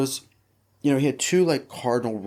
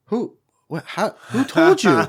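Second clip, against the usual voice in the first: high, loud panicked questions fired off in a row; squeaky outraged panic at being found out; shocked disbelief; 12 semitones higher, 10 dB louder than usual. Retold or imitated. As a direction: caught red-handed and scrambling. Caught out by his father.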